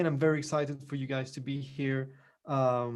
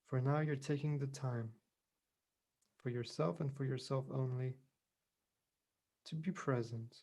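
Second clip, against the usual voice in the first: unhurried meditation voice with long pauses; guided meditation; 5 dB quieter than usual. Real, not acted. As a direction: gently guide them into calm, leaving space between phrases.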